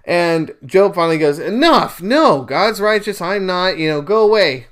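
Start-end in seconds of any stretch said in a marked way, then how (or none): 1.4-4.7 fed-up outburst. Acted. Job snaps at his friends.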